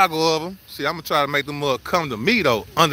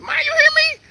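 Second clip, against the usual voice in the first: excited. Real, not acted. Hyped over his gator.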